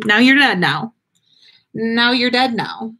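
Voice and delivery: ordinary delivery, nothing marked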